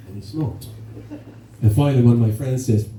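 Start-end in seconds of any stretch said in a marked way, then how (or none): 0.1-0.7 dropped much softer for the last repeat; softer punchline. Shown as a demonstration. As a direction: let the last "smoked" fall away softly.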